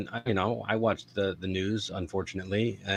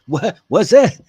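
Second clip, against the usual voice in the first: put-on deaf old man; 12 semitones higher, 11 dB louder than usual.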